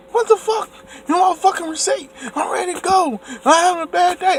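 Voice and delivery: high mock angry outburst; hammed-up blowup pitched high; pretend outburst. Imagining yelling at the cashier, mock angry. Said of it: "if I would have been like".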